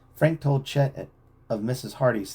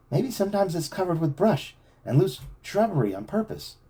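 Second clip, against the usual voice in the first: reasoning; 8 semitones higher than usual. A narrator's reading. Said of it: "Frank reasoned".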